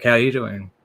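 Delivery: ordinary delivery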